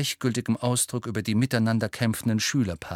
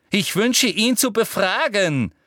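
much higher, louder call; angry shout; 10 semitones higher, 5 dB louder than usual. A narrator's reading. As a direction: demand furiously. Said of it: "rief Umbridge zornig". (zornig gerufen)

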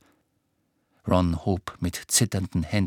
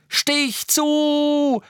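high, desperate yell; full-force urgent shout; screaming; 5 dB louder than usual. A narrator's reading. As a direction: scream the order with a long-held last word. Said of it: "schrie Harry".

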